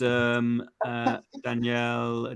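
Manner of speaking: ordinary talk, nothing marked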